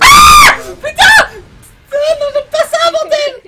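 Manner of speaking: high terrified screaming; scared scream. Real, not acted. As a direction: shriek in real fright. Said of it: "t'as crié vraiment comme une pucelle".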